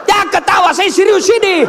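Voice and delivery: high-pitched comic protest; mock scolding. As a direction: scold the laughing crowd in pretend indignation. Mock scolding of laughing audience. (pura-pura marah, nada melengking tinggi)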